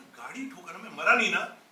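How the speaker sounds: mock indignation, building to a loud finish; retold indignant protest. Boy protests to mother beating him over the crashed car, indignant.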